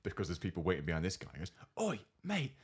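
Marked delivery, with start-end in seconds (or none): 1.8-2.5 high, slow yell across the room; shouted insult. Retold or imitated. Chav girl yells at the rude customer, a high, slow shout. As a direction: call him out from the far booth. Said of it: "shouts across the entire restaurant".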